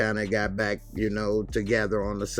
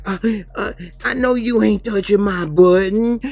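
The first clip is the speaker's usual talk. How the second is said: much higher, louder put-on voice; outraged mock driver; 11 semitones higher, 10 dB louder than usual. Bus driver outraged at Kelly, high put-on voice. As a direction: squeal in outrage about the button.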